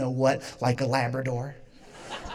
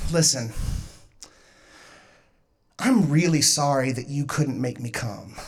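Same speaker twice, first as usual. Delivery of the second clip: solemn mock apology; 8 semitones lower, 3 dB quieter than usual. Boyfriend's solemn apology, retold.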